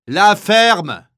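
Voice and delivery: very high, loud yell; yelled order. Acted. Kapo bursting into the block, yelled command. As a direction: bellow it to silence them. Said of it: "hurle le capot".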